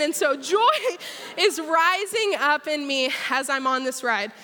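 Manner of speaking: ordinary talk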